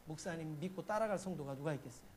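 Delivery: ordinary talk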